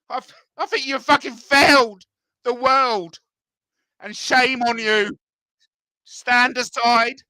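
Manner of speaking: high, loud indignant rant; getting upset. Real, not acted. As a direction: tear into America, fed up and loud. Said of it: "I'm getting too upset".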